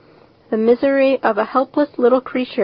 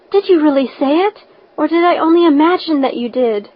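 breathless; 5 semitones higher, 3 dB louder than usual. A narrator's reading. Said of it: "in a breathless whisper".

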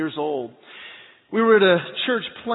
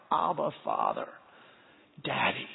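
tender cry; 7 semitones lower, 7 dB quieter than usual. Retold or imitated. Crying out to God as Father.

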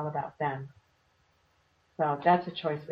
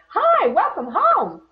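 much higher, louder and slow, sunny greeting; cheerful welcoming house; 10 dB louder, slower than usual. Pretend. A home greeting its owner at night, bright and welcoming. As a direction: greet them like a delighted house. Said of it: "your home should rise up and say".

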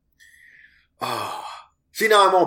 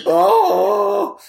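dying mule wail; 5 dB louder than usual. Real, not acted. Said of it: "I sound like a dying mule".

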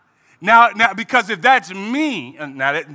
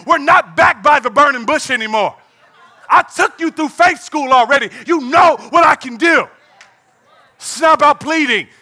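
higher, louder, commanding God; God rebuking; 4 semitones higher, 3 dB louder than usual. Acted. God scolding Moses, higher and louder.

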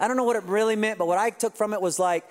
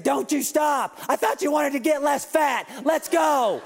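imitating a fitness coach barking orders through a headset, higher; mock coach yell; 6 semitones higher than usual. Coach on the headset yelling at the class to keep going, mock yell. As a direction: push the class hard like a drill coach. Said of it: "Rick yells at you the whole time".

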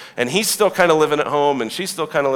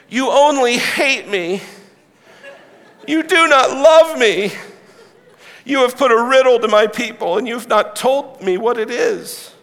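whining wife; 9 semitones higher than usual. Acted. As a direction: plead and pout like a hurt new wife. Samson's wife nagging him.

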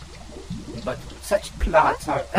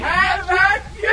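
battle cry; slower than usual. Acted. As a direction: charge in with a hammy yell. Villain lunges to attack.

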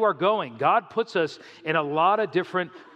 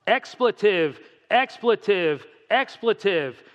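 mock swearing tirade, higher-pitched; retold screaming; 4 semitones higher, 4 dB louder, slower than usual. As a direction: fire off each word like a censored curse. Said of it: "he started screaming at me".

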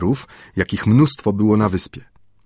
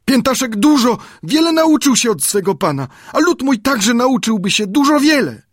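put-on high, enthusiastic native boy; high and full of zeal, dreaming aloud; eager Friday. A narrator's reading. Friday voiced by narrator. (z zapałem, głosem Piętaszka)

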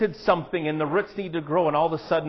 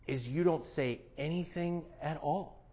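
hushed; 11 dB quieter than usual. Acted. Point about staying silent.

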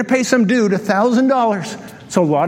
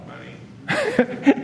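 much higher, soft squeak on money; a playful falsetto reveal; squeaked punch word; 14 semitones higher, 6 dB quieter than usual. Acted. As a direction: pop the word up high and light.

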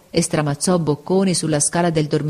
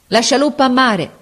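captain's shout; 7 semitones higher, 4 dB louder than usual. A narrator's reading. Captain orders the lifeboat lowered, shouting. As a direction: shout the order across the deck. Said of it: "gridò il capitano".

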